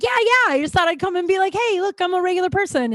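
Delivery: high, quick, eager retelling; mock eager explaining. Regular showing the bartender she's sober, mock eager. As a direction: play up the chirpy self-introduction, pitched high.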